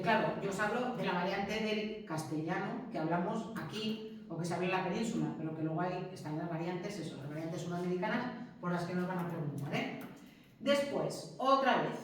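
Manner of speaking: ordinary talk, nothing marked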